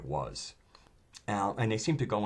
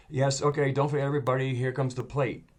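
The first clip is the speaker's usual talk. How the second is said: louder, higher preacher's call to the congregation; 5 semitones higher, 5 dB louder than usual. Acted. Preacher rallying the congregation for the collection, rousing. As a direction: work the room like a preacher. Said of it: "almost like a preacher".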